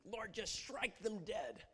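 slow, high, forceful imprecation; harsh curses. Acted. Preacher acting a believer cursing an enemy. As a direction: call down wrath on the enemy. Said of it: "harsh curses against your enemy".

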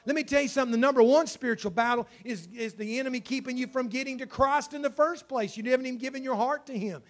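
loud, high, urgent preaching; impassioned altar call. Real, not acted. Preacher appealing to those in spiritual battle, urgently. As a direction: press the appeal home at full voice.

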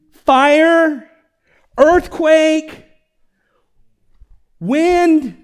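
high, booming one-word bursts; dramatic shouted list. Acted. Preacher recalling Elijah's mountain to the congregation, dramatic shouts. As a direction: call each one out loud and drawn. Acting out the mountain story's upheaval.

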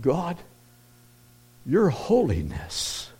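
slow, hushed and awed, drawing out holiness; slow reverent prayer. Retold or imitated. Widower recalling the moment his prayer changed, slow and awed. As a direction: savour each word as a new revelation. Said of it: "it changed".

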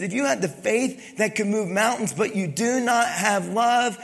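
preacher repeating the verse for emphasis; preaching. Acted. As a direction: lean into the word love.

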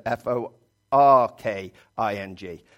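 forking spelled letter by letter, F O R K I N G. Shown as a demonstration. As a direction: spell forking out slowly, one letter at a time. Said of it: "but they say, I said".

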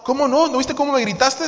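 acusación aguda y fuerte, actuada; échale en cara el grito; reclamo de pareja. Acted. Acted spouse quarrel, accusing. (reclamo indignado, teatral)

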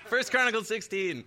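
preacher, relieved the slide is found, calling out the passage excitedly; excited relief. Real, not acted. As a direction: announce it triumphantly at last.

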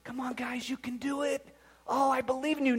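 mock the soft coach, almost whispered; soft mock encouragement. Shown as a demonstration. Wrestling coach mocking a gentle pep talk, soft and light. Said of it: "it's not".

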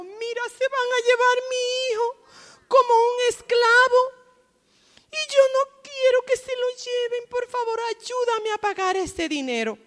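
súplica más aguda, un toque de llanto; viuda suplicando. Acted. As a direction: implora con voz alta y afligida. La viuda pide ayuda a Elías. (la viuda le ruega a Elías, aguda y llorosa)

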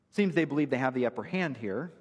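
ordinary talk, nothing marked

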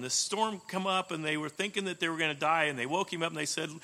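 ordinary delivery

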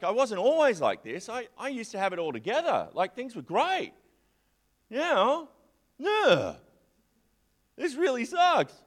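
put-on sulky complaint about the good old days; whiny nostalgia. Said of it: "getting a bit nostalgic".

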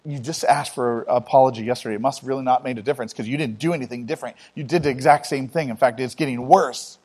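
normal talking voice

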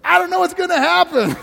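excited. Acted. Pastor flipping worry into joy, high and thrilled. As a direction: say it like a wonderful surprise. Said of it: "change it around and go".

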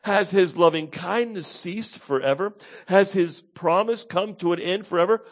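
high, loud anguished questions; acted impassioned lament; pained doubting. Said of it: "when we are in deep pain".

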